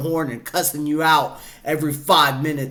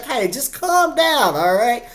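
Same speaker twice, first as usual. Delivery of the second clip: high-pitched mock soothing girlfriend voice; chill girlfriend; 8 semitones higher than usual. Imitating girlfriend calming a hot-tempered driver, high chill voice. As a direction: gently talk him down in falsetto. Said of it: "she's the cool, chill type".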